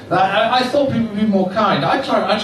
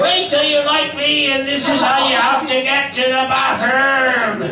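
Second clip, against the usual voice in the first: high, put-on voice of an older man; mock an older man calling out, pitched well up; heckling older man; 8 semitones higher than usual. Older audience man warns the comic about ageing, high mocking voice. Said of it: "men in the audience who go".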